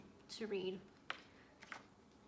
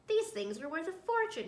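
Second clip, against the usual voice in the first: excited; 8 semitones higher, 11 dB louder than usual. Acted. Dodsworth finds valuable books.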